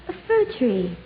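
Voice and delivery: ordinary talk, nothing marked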